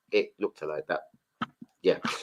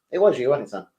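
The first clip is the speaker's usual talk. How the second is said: ordinary talk, nothing marked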